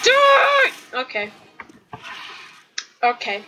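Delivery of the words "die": high, drawn-out battle cry; heated gamer yell; raging shout. Real, not acted. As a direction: scream it at the enemy. Yelling at enemy in game.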